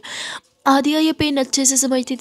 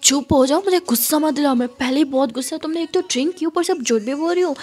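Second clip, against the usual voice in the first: angry scolding; 4 semitones higher than usual. Acted. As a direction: scold her for lying. Angry woman scolds the girl.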